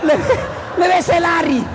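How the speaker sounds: high, laughing give-up cry; laughing exasperation. Retold or imitated. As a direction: laugh as if the jin can't bear it. Jinn fleeing from the nagging wife. (jin tertawa putus asa dengan suara tinggi)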